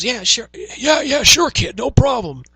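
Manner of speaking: very loud, high mock salesman; over-eager caricature, pushed to the top; John Laurinaitis. Salesperson brushing off a gift card request, hammed-up impression. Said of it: "make him sound like John Laurinaitis".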